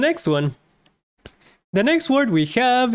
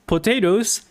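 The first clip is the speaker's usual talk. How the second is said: normal talking voice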